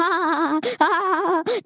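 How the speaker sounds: high-pitched, drawn-out laugh; wavering high laugh. Real, not acted. Breaking off his joke-reading in a high laugh.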